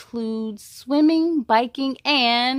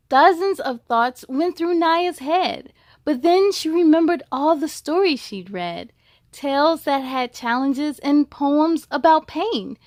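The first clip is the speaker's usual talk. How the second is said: louder, rhythmic read-aloud voice; story narration; 2 semitones higher, 6 dB louder than usual. A narrator's reading. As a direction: read the rhymes to kids with bounce. Reads rhyming picture book.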